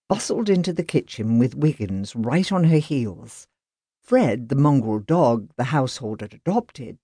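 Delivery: ordinary delivery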